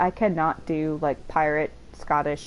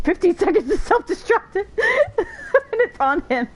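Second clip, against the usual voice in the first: high-pitched, laughing through the words; helpless giggling as you talk; cracking up; 14 semitones higher, 5 dB louder, slower than usual. Real, not acted. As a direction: really break into laughter mid-sentence. Cracking up at game event.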